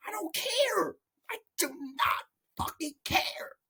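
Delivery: high, strained, softened whine; mock-tearful, at the end of patience; exasperated meltdown. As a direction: squeeze it out high, as if near tears. Comic meltdown at the character.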